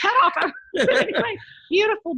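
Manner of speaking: laughing it out, high and loud; giggly, bright burst of laughter. Real, not acted. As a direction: breaks into a real laugh mid-sentence. Laughing about crying on plane.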